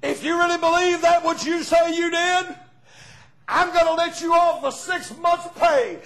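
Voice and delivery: loud, high declaration building to a shout; owner's proclamation. Retold or imitated. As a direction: proclaim the sentence with full force. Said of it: "He said".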